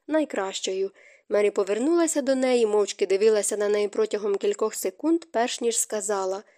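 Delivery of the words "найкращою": plain reading voice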